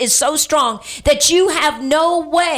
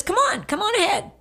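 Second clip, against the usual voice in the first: gentle invitation; 8 semitones higher, 4 dB quieter than usual. Retold or imitated. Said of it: "And Jesus says".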